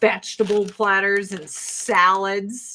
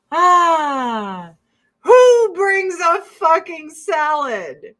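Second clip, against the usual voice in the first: much higher, louder and slow disbelief; mock outrage; 8 semitones higher, 9 dB louder, much slower than usual. Real, not acted. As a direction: drag it out, scandalized. Outraged about the salad.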